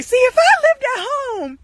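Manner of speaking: high-pitched complaining rant; re-enacted tirade in a high voice; acted rant. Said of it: "I just go on this rant".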